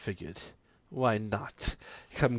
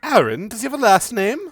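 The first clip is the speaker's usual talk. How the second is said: intrigued mother; 7 semitones higher, faster than usual. Acted. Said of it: "she goes".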